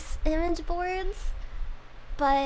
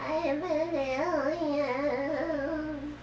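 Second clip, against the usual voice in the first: very slow, softer sing-song alien; calm breathy gray alien; 4 dB quieter, much slower than usual. Pretend. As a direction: drawl it out like a spaced-out alien. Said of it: "do an alien voice into the fan".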